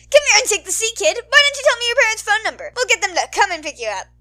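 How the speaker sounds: big, loud, cheery hero voice; loud radio host. Acted. Mike cheering up the lost kid, loud. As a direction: boom out the reassurance.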